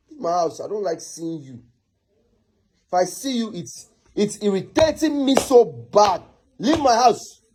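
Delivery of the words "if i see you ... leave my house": higher, exasperated outburst; irritated. Acted. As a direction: let irritation push the pitch up into an order. Irritated, orders her out.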